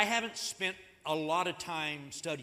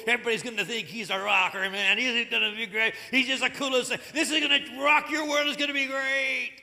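loud, much higher surfer-dude Gabriel, half sung; laid-back dude angel; 5 semitones higher, 10 dB louder than usual. Pretend. Preacher mocks a cool Gabriel telling Zacharias about a son, loud surfer-dude voice. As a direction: hype him up like a stoked surfer, man. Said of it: "Gabriel showed up and said".

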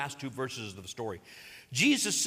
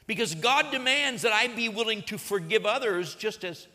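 higher, louder preaching build; full-force preaching; 6 semitones higher, 3 dB louder than usual. Acted. Preacher urging church to keep cross central, full force. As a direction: drive it home from the pulpit.

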